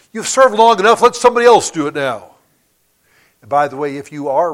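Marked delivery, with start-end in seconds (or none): 0.1-2.3 much louder, high-pitched, forceful urging; mock urging shout. Preacher voices Satan's lie to believers, loud high mock urging. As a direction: belt it out as a tempter's argument.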